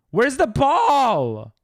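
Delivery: high, loud and slow; high, drawn-out loud question; frantic. Real, not acted. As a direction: call out searching desperately. Searching frantically in game.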